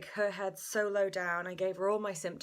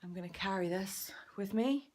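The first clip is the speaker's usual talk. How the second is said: whispering so as not to disturb the nursery. Real, not acted.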